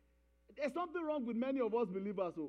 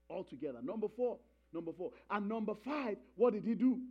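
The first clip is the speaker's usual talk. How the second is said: ordinary delivery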